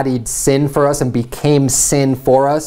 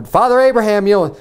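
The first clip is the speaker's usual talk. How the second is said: rich man begging from hell, voiced higher; pleading rich man; 8 semitones higher than usual. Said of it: "he's like, you know".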